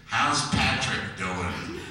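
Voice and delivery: slow, lightly voiced New Jersey aunt; aunt's voice. Aunt calling the mother, lightly voiced and slow. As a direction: ask it like a chatty aunt. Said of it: "would call her and say".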